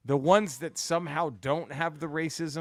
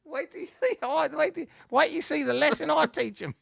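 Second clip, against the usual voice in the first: shrill falsetto Monty Python housewife, much higher and louder; Monty Python lady; 5 dB louder than usual. Retold or imitated. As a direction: squawk it like a Python pepperpot. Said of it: "made her like every female Monty Python character".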